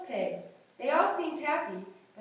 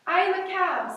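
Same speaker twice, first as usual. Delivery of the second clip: high cow voice; 6 semitones higher, 7 dB louder than usual. Acted. Miss Moo answers giraffes.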